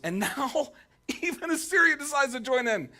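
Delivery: can you believe this too; laughing exasperation. Retold or imitated. Preacher voicing the psalmist, exasperated and laughing. Psalmist's last-straw line.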